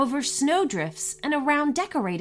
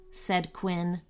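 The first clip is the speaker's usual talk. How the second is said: ordinary delivery, nothing marked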